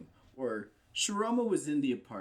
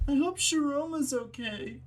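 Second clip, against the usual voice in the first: high, loud call from afar; background shouter; 7 dB louder than usual. Retold or imitated. Guy in the background calling across the alley, shouting. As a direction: imitate a bystander yelling out. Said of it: "you hear this guy say".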